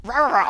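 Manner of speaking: high, silly roaring noise; playful roar mimicking a monster; growl-chant in a high, joking voice; mock monster roar. Mimics monster that just appeared.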